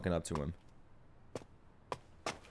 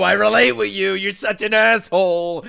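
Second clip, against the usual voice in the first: impassioned outburst; 4 semitones higher, 7 dB louder than usual. Real, not acted.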